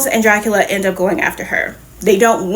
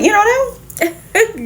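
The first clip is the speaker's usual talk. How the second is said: know jumps much higher in disbelief; high incredulous mock question; mock incredulity; 12 semitones higher than usual. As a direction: squeak the know in shock. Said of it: "Captain Marvel was like".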